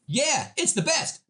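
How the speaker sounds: high, slow, syrupy sales pitch; a salesman laying it on thick; cheesy salesperson. Retold or imitated. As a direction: oversell it with a big fake smile. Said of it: "And then you go".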